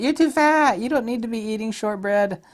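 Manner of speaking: high, loud mocking commenter; mock heckler. Rude commenter scolding the reviewer, high and loud. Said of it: "someone's gonna leave a comment, like".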